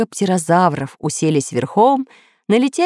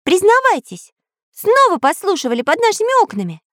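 high, quick accusing voice; suspicious accusation. A narrator's reading. Karandash accuses pirates of eavesdropping, high and suspicious. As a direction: demand a confession in a high, suspicious voice. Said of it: "подозрительно спросил Карандаш".

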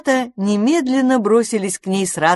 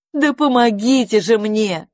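higher, slow call for help; 5 semitones higher, 4 dB louder than usual. A narrator's reading. Lady calls the others to help, crying out. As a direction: cry out to the others. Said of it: "закричала она остальным".

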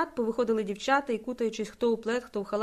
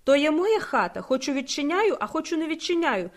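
higher, defiant retort; 6 semitones higher, 3 dB louder than usual. A narrator's reading. Landlady defying the soldiers at her door, defiantly. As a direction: throw it back as a challenge. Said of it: "з викликом відповіла".

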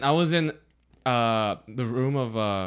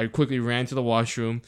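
usual speaking voice

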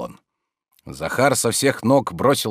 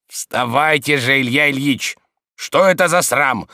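higher, insistent scolding; raised voice; 5 semitones higher, 4 dB louder than usual. A narrator's reading. Servant reproaching his sleeping master, with raised voice. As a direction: raise your voice to rouse a sleeper. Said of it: "возвышая голос".

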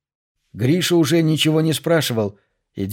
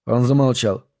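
usual speaking voice

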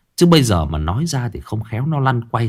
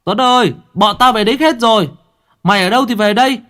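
loud, very high call through cupped hands; 12 semitones higher, 5 dB louder, faster than usual. Acted. Kids calling the missing Tuấn through cupped hands. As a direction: call far off into the dark. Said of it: "bắc loa tay mà gọi".

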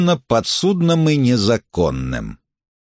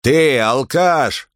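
slow barked insult; shouting at trespasser; 4 semitones higher, much slower than usual. Acted. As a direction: bark it at the drunk. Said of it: "рявкнул охранник". (рявкнуть с расстановкой)

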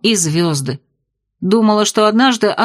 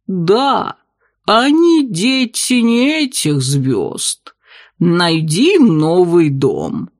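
gurgling tentacle alien; much slower than usual. Acted. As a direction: stretch it out, otherworldly. Octopus bartender speaks.

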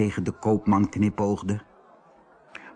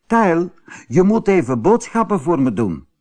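higher-pitched motherly call; 7 semitones higher, 3 dB louder than usual. A narrator's reading. Mother calls Tijl to run errands, calling out. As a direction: call your child over for an errand. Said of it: "riep zijn moeder".

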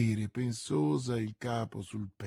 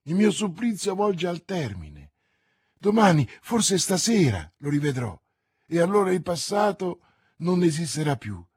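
loud, raised, hopeful declaration; elated; 4 semitones higher, 7 dB louder than usual. Acted. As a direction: proclaim it with rising joy. Bonacieux exults that her torment ends.

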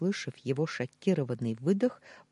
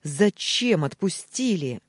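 louder, slow angry demand; growled; 4 semitones higher, 6 dB louder, slower than usual. A narrator's reading. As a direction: snarl the question. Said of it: "зарычал".

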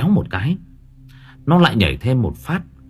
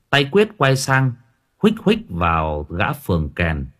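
plain reading voice

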